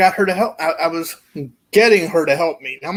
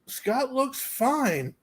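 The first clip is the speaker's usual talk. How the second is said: deadpan boss; 4 semitones higher, 10 dB quieter, much slower than usual. Retold or imitated. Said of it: "he's like".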